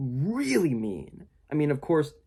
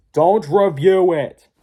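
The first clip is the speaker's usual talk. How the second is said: drawn-out louder yell; shouting; 7 semitones higher, 10 dB louder, much slower than usual. Retold or imitated. Doug begging Lindsay not to review, yelling. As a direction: plead it at full volume. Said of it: "Doug's like".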